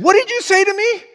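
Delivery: high, loud indignant retort; mock outrage. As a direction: imitate an affronted churchgoer, voice shooting up. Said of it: "her eyes got big".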